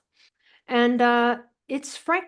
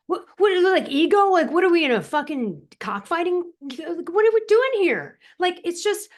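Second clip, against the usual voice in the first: higher and louder, exasperated rant; indignant outburst; 7 semitones higher, 5 dB louder than usual. Real, not acted. Telling the host about egotistical endocrinologists, loud and indignant. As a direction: let real outrage burst out.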